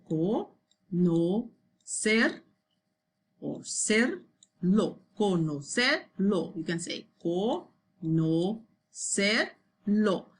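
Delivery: "co, no, ser, lo" as separate syllables. Shown as a demonstration. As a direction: break the word into syllables with pauses. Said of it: "And you can break it down".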